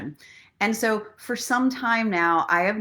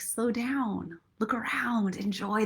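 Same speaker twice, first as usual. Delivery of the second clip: softer inner voice, ending in a whisper; 2 semitones higher, 6 dB quieter, slower than usual. Retold or imitated. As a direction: hush it like a voice inside. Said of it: "inside it's like".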